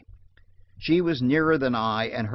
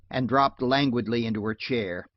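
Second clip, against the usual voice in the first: ordinary delivery, nothing marked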